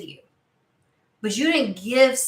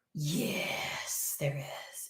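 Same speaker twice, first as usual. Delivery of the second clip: low, barely voiced reply; hushed whisper; 7 semitones lower, 12 dB quieter, much slower than usual. Spirit answering a specific question at last, in a whisper.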